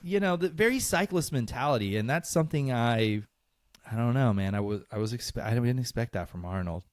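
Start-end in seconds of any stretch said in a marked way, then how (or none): none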